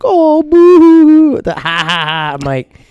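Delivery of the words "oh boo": very high, loud teasing jeer; mocking boo. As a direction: laugh at him. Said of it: "they're like".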